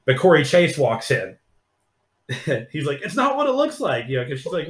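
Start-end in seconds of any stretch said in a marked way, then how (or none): 3.0-4.1 high, hurried excuse; mock caught excuse. Caught guy squeaking his denial to his stepmom.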